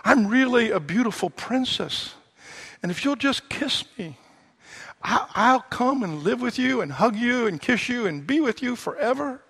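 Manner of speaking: sweetly pleading, a touch of princess; frog princess voice. Acted. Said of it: "the frog says".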